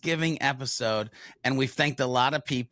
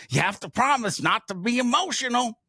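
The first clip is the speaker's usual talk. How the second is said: much higher, quicker self-mocking quote; mocking own words; 10 semitones higher, faster than usual. To his family, quoting what he told Liz: high mocking. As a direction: send up his own dumb line. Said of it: "dumb shit comes out of my mouth like".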